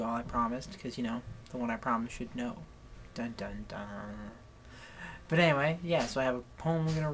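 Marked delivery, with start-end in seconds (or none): 3.1-4.3 low, hushed dun-dun-dun; mock suspense sting. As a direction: tease with a soft, ominous musical sting. Mock suspense sting after a teaser.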